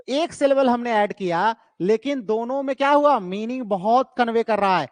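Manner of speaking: ordinary delivery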